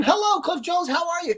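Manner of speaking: very high, bright singsong hello; high greeting voice. Real, not acted. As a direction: chirp it up high and friendly.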